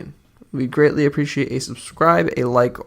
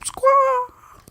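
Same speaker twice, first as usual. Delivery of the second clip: high, piercing seagull squawk; screechy seagull voice. Acted. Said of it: "I will squawk like a seagull".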